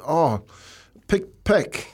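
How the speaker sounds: high, excited guess at the letter; eager guessing. Retold or imitated. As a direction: guess the word, pitch rising high. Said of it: "and they go".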